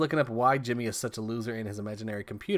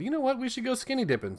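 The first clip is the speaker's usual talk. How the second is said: high-pitched mock teenager voice; put-on high, perky suggestion; teenagers deciding; 13 semitones higher than usual. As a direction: imitate a kid pitching a fun idea, voice way up. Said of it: "they all decide".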